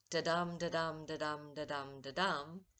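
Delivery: "da-dum" with the dum hit hard, fading; da-dum beat. Shown as a demonstration. Teacher tapping out iambic meter for students, chanted beat. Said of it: "That rhythm, or meter".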